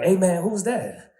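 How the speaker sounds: high slow nosy question; homeboy asking who called; curious homeboy. Retold or imitated. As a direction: drag it out, nosy friend. Homeboy asks.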